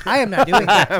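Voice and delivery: loud, firm refusal; flat-out refuse; emphatic refusal. Retold or imitated. Refusing to slap pig.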